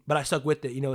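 ordinary delivery

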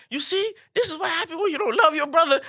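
high-pitched imitation of a mother lecturing; mock motherly telling-off; scolding mother. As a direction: hand down the lesson, worked up. Mother scolding in a retold scene.

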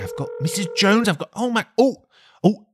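normal talking voice

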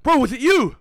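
loud, high accusing demand; heated challenge across the street; angry challenge. Retold or imitated. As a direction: call the guy out, heated. Confronting the pointing man.

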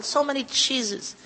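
ordinary talk

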